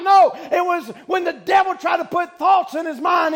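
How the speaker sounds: normal talking voice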